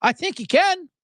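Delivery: high, lightly voiced dopey phone caller; Brian Regan impression. Caller answering the network's phone, mildly goofy and high. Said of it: "Regan goes".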